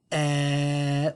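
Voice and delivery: "Eh" buzzing in the throat; voiced eh. Shown as a demonstration. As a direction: feel the voice box buzz on "Eh". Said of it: "Does my voice box vibrate when I say the eh sound".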